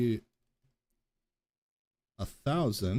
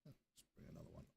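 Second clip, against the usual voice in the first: whispering; 30 dB quieter, much faster than usual. Real, not acted. Unvoiced aside.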